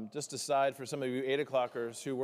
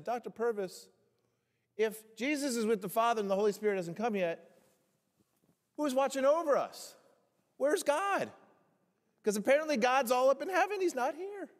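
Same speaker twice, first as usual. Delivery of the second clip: high-pitched, needling mock question climbing higher; cheeky student question; 9 semitones higher, 4 dB louder than usual. Seminary student needling his professor about where God is, high and mock-innocent. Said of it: "So I said".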